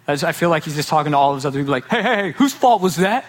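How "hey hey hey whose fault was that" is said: hammed-up devil barking at his minions; mock devil voice. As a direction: play the devil as a squeaky boss. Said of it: "I don't know why the devil talks like that".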